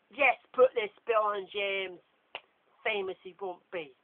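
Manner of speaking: loud, slow scheming command; Draco's loud order. Acted. Draco to his friends, a loud scheming order. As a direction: bark the plan at his friends.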